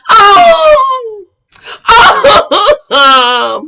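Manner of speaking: high, loud drawn-out "oh"; hammed-up hesitation noise; mimicked stalling. Mimics her stalling before answering.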